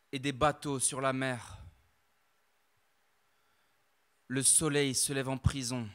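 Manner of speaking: measured, deliberate poem delivery with long pauses; slam poem recital. Acted. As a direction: let each line land like a verdict. (poème slam récité, posé et grave)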